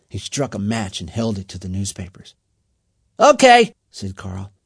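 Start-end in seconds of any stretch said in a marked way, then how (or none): 3.2-3.7 high, loud call; rally them with a shout; excited commanding shout; urging the gang. A narrator's reading.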